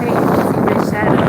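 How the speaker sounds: quick mock-silly voice. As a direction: toss it off in a teasing voice. Mocking people who mind onlookers.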